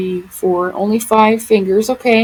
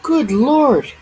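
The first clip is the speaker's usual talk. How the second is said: usual speaking voice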